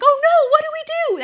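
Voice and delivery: high loud panicked cry. Retold or imitated. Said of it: "We're like".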